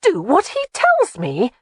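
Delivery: put-on high, incredulous young woman; outraged echo of his words; indignant Jamie. A narrator's reading.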